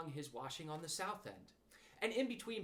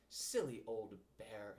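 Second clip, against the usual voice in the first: slow, low and fond; loving; 5 semitones lower, slower than usual. Acted. Christopher Robin lovingly teases stuck Pooh, slow and low. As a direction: say it tenderly, slowly. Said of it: "in such a loving voice".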